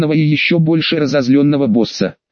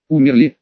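"Умерли" with a rising question tune; questioning echo. A narrator's reading. Fighter checking the demon's news, "Умерли" as a rising question. Парень переспрашивает демона.

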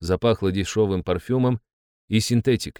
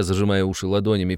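plain reading voice

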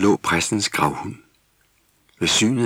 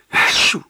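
loud, breath-burst sneeze; whooshing atchoo; acted sneeze; 6 dB louder than usual. A narrator's reading. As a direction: sneeze it out explosively. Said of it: "før Jule nyste".

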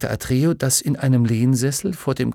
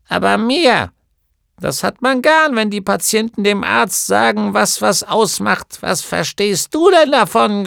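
put-on high, louder, often slow scolding old woman; indignant gnome healer; 7 semitones higher, 6 dB louder than usual. A narrator's reading. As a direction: scold the know-it-all boy. (Heilerin schimpft mit Atreju, höher, lauter, langsam)

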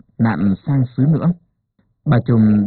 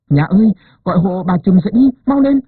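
high, louder urgent plea; urgent pained plea; 7 semitones higher, 4 dB louder than usual. A narrator's reading. Wife urging husband to wake the midwife, high urgent plea. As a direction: beg him quickly through the pain. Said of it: "chị Pha gọi chồng".

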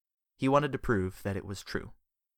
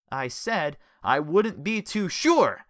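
louder, slow defiant repeat; 6 semitones higher, 6 dB louder, slower than usual. A narrator's reading. Richard repeating his warning to Jellyfish, slow loud defiance.

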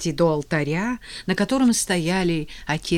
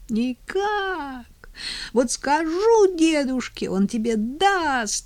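put-on high, slow child's howl; wailing child; 9 semitones higher, slower than usual. A narrator's reading. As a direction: wail like an offended boy. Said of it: "завопил Мишка".

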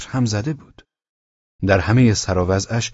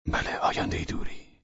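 soft, hushed mumble; under the breath; 9 dB quieter than usual. A narrator's reading. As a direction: murmur it to yourself. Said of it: "تون زیر لب گفت". (زیر لب، آهسته)

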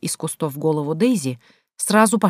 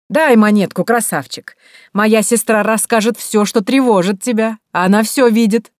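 high, loud wheedling pitch; 4 semitones higher, 5 dB louder than usual. A narrator's reading. Mrs Paddington begging the baron for a coin, loud wheedling. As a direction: hustle him for a coin.

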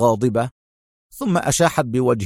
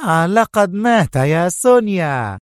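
proud; 7 semitones higher than usual. A narrator's reading. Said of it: "ابتسمت في فخر".

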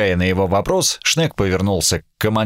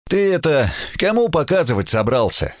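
lazily amused challenge, higher-pitched; relaxed, lazy; 8 semitones higher than usual. A narrator's reading. Duty officer questions the raging admiral, lazy and relaxed. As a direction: ask it casually, unhurried. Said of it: "Расслабленно и с линцой в голосе".

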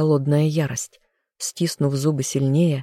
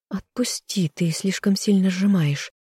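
higher, lightly voiced woman; beloved woman; 4 semitones higher than usual. A narrator's reading. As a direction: gently plead to be let go. Beloved asks him to let go.

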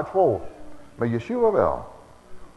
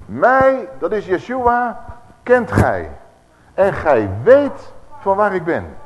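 proclaiming; 5 semitones higher, 5 dB louder, slower than usual. Acted. Preacher proclaims Yeshua's words.

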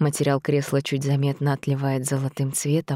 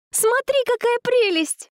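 put-on bubbly sorority girl. Dasha thrilled.

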